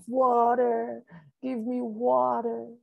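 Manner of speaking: high, drawn-out plea; thirsty begging. A narrator's reading. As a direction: moan high and long, desperate for a drink. Keeper begging for water, parched.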